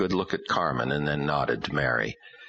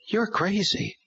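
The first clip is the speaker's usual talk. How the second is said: higher daughter voice, scornful; a hint of a girl snapping back; slower than usual. A narrator's reading. Daughter lashing out.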